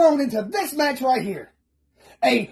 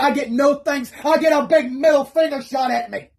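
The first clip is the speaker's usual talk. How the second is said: normal talking voice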